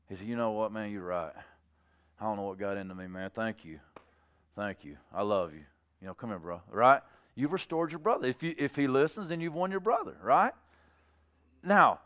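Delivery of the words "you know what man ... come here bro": low, soft, grateful admission; humble apology. Acted. Corrected brother thanking the friend who restored him, humble apology. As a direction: give in quietly and thank him.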